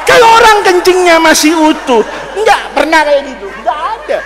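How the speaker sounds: loud, high preaching to the crowd; preacher booming at the congregation; fiery preaching. Acted. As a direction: preach it hard. Preacher at full force.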